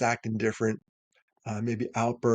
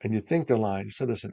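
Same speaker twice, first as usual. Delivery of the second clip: ordinary delivery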